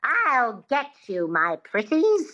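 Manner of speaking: high, twangy witch voice; cackly twang, slow and stretched; Wicked Witch. Shown as a demonstration. Coach showing twang to the learner, Wicked Witch voice. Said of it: "Think of the Wicked Witch".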